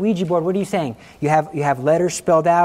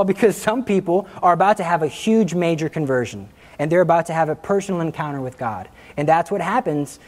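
usual speaking voice

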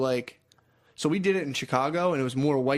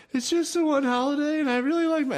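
put-on much higher pleading girlfriend voice; 14 semitones higher than usual. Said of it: "she was more like".

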